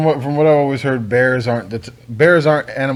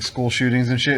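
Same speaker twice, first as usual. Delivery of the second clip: ordinary talk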